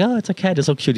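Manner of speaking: high, cooing gush over something cute; mock cooing. People admiring his cat tattoo, in a high cooing gush.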